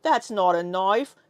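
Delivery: slow, mock Australian accent; Crocodile Dundee. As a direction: draw it out in a hammy Aussie accent. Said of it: "Crocodile Dundee".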